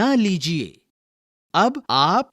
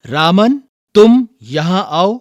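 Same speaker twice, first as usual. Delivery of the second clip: louder, higher call across the classroom; 4 semitones higher, 8 dB louder than usual. Shown as a demonstration. Teacher models a command for the class to repeat, calling out loudly. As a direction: call the student over, loud and clear. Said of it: "अब मैं कुछ वाक्य बोलूंगा".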